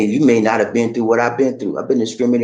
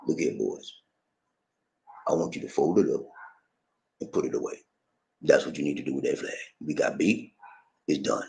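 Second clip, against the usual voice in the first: much lower and softer, calm authority; stern general; 6 semitones lower, 9 dB quieter than usual. Retold or imitated. General Lee tells his soldiers to fold the flag, low and quiet. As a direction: settle them down like a weary old commander.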